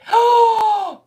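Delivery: big, loud, high gasp; astonished gasp. Real, not acted. Reading viewers' surprising news, huge astonished gasp. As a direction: suck in a huge shocked breath.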